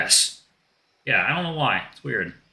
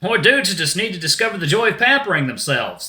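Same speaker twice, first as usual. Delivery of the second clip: raised, laid-back dude voice; earnest shark dude; 6 semitones higher, 4 dB louder than usual. Acted. Shark defends pampering to the guys, earnest dude voice. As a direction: preach pampering as a surfer dude.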